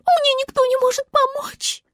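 very high plaintive wail; acted little-creature whine; tearful star. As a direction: lament hopelessly in a tiny high voice. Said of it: "заплакала звезда".

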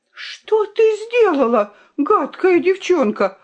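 high-pitched, outraged attack; furious scolding. A narrator's reading. The fox turns on Violinka, scolding furiously. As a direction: round on the culprit, pitch soaring. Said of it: "обрушилась лиса на Виолинку".

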